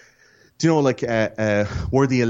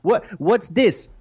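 high, slow put-on Mexican accent; 11 semitones higher than usual. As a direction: Carlos Mencia style Mexican accent. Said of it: "your Mexican accent is wonderful".

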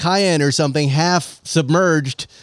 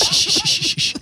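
hushing. Pretend. Hushing dying friend.

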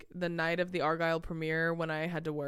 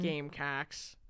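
"Gamecocks" in a Wisconsin accent; 3 semitones lower, 4 dB quieter, slower than usual. Shown as a demonstration. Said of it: "Like that".

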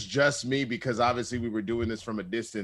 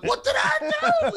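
high squeaky disbelief; surprised; 6 dB louder, faster than usual. Retold or imitated. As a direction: act baffled, voice jumping up. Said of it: "I was like".

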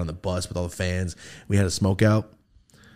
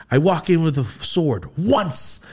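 slow, higher-pitched mock exasperation; imitating indignant friend; 6 semitones higher, slower than usual. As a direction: act out a friend's exasperated protest. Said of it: "the way she said it made me laugh".

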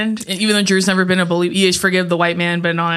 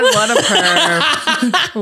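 slow quote; 4 semitones higher, much slower than usual. Retold or imitated. Quoting a movie line.

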